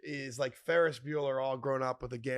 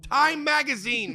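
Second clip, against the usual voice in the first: mock boast; 11 semitones higher, 10 dB louder than usual. Mock proud announcement to Mom.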